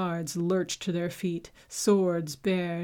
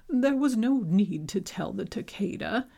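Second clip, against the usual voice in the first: ordinary delivery, nothing marked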